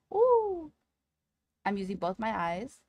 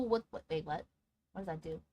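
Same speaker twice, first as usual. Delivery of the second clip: ordinary talk, nothing marked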